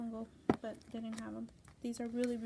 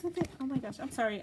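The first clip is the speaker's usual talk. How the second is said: normal talking voice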